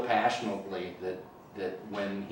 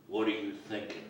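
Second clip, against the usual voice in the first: softer, held-back confrontation; retold intense question; 6 dB quieter than usual. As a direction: imitate a boss suddenly going quiet. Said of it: "looks me right in the eye and he says".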